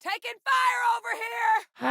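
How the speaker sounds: high, urgent shout under fire; combat shout. Acted. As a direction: yell it over the gunfire.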